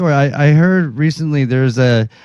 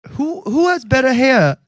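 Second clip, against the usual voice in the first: higher-pitched put-on British accent; 9 semitones higher than usual. Asking Louis the next question in a British accent. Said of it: "questions in his best British accent".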